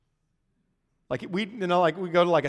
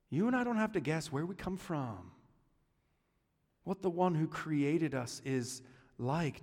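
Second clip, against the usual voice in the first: earnest sermon refrain; 5 dB quieter than usual. Acted.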